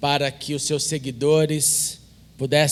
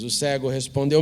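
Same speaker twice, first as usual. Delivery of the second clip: ordinary delivery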